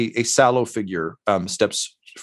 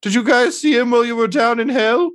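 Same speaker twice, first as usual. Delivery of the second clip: higher and louder, a tired, rambling host; Mosifus Dirt, weary; 13 semitones higher, 4 dB louder than usual. Acted. Mosifus Dirt telling the party about the hard winter, weary and pitched up. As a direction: sigh through the winter woes, pitched up. Same NPC voice continues.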